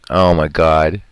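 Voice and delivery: ordinary delivery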